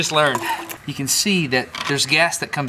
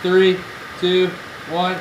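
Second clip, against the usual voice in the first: countdown call; 5 semitones higher, slower than usual. Real, not acted. Countdown before the shot.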